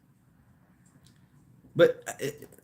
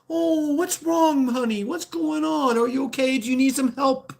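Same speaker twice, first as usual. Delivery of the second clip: sugary concerned bystander; 12 semitones higher than usual. Retold or imitated. Streamer mocks how strangers coddle a crying woman, high and cooing. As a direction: coo it like an overdone sweet stranger.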